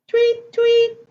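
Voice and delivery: high chirped tweets; high, even mock birdcalls; bird tweets. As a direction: chirp like little birds. Story reader voices little birds.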